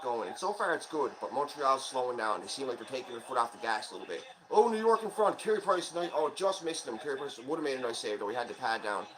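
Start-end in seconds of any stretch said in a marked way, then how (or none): 4.5-7.0 higher, louder running game call; live play-by-play. Real, not acted. Fan narrating the hockey game live for viewers, excited play-by-play. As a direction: call every rush as it happens.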